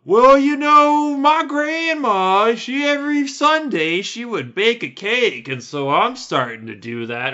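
high, slow mocking grandma voice; squeaky mock old woman drawling about cake; mocking old lady. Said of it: "but they're just like".

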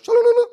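loud, high-pitched nonsense burst; mock babble. Preacher clowning for congregation in a story, mock babble.

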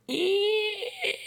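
high squeaky creak of a door; a door squeaking slightly open. Retold or imitated. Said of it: "the door just goes".

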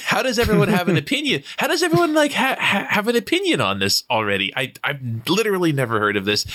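baffled, pitch jumping high; incredulous. Retold or imitated. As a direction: retell the confusion, incredulous. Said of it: "And I was like".

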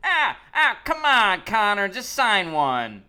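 hammed-up begging shout at a player; mock fan yell. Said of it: "But this guy goes".

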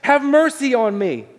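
high, loud, drawn-out plea; imitated desperate yell to Jesus. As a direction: call out for mercy, slow and high. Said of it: "and he's yelling".

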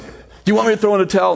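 fast worried question from the corner; urgent manager. Retold or imitated. Manager voiced to beaten boxer.

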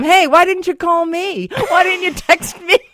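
high, loud hammed-up complaint; playful shouted accusation; mock indignant demand. Acts out phoning the husband.

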